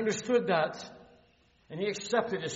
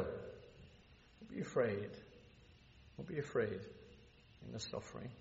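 reassuring; 5 semitones lower, 11 dB quieter than usual. Real, not acted. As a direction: ease their fear gently. Preacher comforting the suffering.

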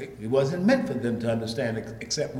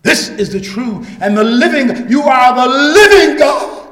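loud, raised roll call, hammering the refrain; full-force preaching; 12 semitones higher, 14 dB louder than usual. Real, not acted. Preacher denouncing other gods to the congregation, full-force preaching. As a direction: pound out each name like a verdict.

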